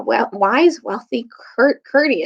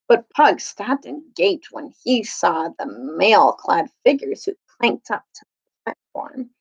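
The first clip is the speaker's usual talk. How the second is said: plain reading voice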